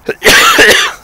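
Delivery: loud real coughing fit. Real, not acted. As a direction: hack out a run of loud coughs. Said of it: "but I'm coughing".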